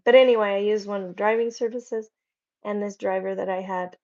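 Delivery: ordinary talk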